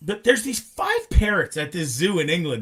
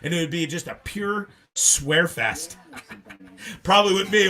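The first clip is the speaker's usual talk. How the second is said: ordinary talk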